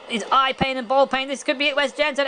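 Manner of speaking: high-pitched, tense commentary; excited play-by-play. Real, not acted. Commentator sensing Wes Jansen may be finished, excited. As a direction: call a possible finish with rising excitement.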